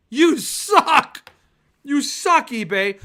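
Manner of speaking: loud, high and indignant; yelling at eBay. Real, not acted. Book collector yelling at eBay after missing a sale, indignant shout. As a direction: shout your outrage at the screen. Scolding eBay over sold arc.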